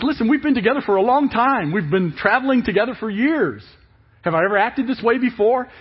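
high, loud earnest donkey voice; donkey begging its master to notice; pleading donkey. Acted. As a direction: plead as a hurt old friend. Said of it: "The donkey says".

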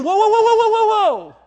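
high, loud repeated whoas; urgent warning. Retold or imitated. Preacher voicing Jesus warning, loud and high. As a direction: cry out to stop them. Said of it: "Jesus is saying".

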